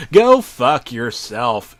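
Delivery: a loud, slow, drawn-out scream. Acted. Jimmy screaming at the crying potato, loud slow scream. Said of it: "the newt screamed".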